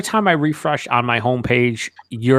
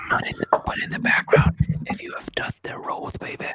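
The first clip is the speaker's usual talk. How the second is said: whispered joke promo; hushed ASMR parody; mock ASMR whisper. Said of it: "you gotta whisper".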